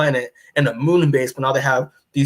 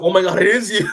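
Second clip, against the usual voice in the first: higher-pitched, imitated tearful outburst; mock bawling; 5 semitones higher, faster than usual. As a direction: act out a mother's overwhelmed recognition. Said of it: "she starts bawling".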